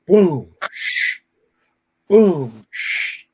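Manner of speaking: loud "boom" then an unvoiced "shh"; mock thud and scrape. Teller imitating a battery dragged up steps, thud then hiss.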